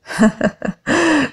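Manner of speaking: derisive high laugh; mocking laugh. A narrator's reading. Hunter laughing at the girl before the mob, mocking. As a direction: scoff with a laugh at her. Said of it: "zaśmiał się drwiąco łowczy".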